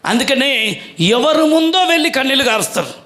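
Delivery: ordinary talk, nothing marked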